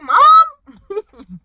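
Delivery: high, loud mock disbelief; hammed-up incredulous question; son's startled question. Son questioning his mother, mock shock.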